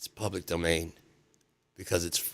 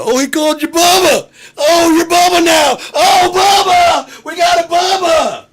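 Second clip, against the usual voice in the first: very high, loud squealing cries; excited shouting; 12 dB louder than usual. Retold or imitated. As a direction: shriek it like delighted relatives. Family shouting over a nickname.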